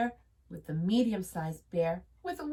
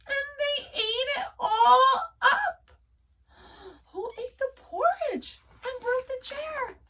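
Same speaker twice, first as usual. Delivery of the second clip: wailing cub; 5 dB louder than usual. A narrator's reading. Baby Bear mourns his porridge, high and wailing. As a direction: wail like a heartbroken little bear.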